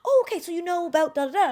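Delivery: high, loud impressed reaction; mock impressed. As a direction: play up a listener's amazed reply. Voices impressed listeners in anecdote.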